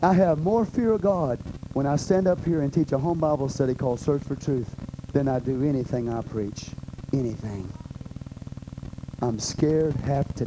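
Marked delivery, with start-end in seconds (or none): none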